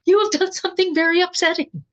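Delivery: loud, high mock scolding; shrieked accusation, hammed up for effect; retold scolding yell. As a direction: exaggerate a yelling mother's reproach. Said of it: "But what I got was".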